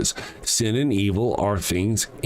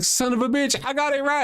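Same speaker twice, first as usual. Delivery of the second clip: mock triumphant Episcopalian. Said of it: "you're like".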